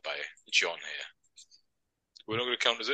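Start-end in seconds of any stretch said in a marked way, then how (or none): 2.3-2.9 mock complaint in a put-on Burnley accent; higher, lightly faked Burnley accent. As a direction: imitate a colleague with a Burnley twang. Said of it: "sound like you're from Burnley".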